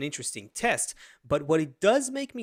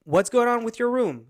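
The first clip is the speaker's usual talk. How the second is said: louder, higher mock scolding; angry dad; 4 semitones higher, 6 dB louder than usual. Dad testing his son without laughing, mock angry. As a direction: play a cross dad, keeping a straight face. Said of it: "the angry kinda".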